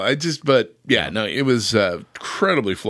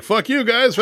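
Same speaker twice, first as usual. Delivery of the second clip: mock [name]; 10 semitones higher, slower than usual. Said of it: "the [name] was like".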